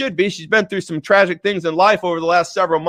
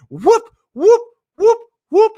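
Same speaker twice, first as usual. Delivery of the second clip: sharp vocal whoop sounds, one leaping much higher; razor whoosh noises; 12 semitones higher, 5 dB louder than usual. Retold or imitated. Telling how he shaved his head: playful whooping razor noises. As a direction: mimic the razor sweeping over the scalp.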